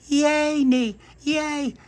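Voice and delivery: very high, loud squeal of joy; a toddler thrilled by jam; delighted baby cheer. Acted.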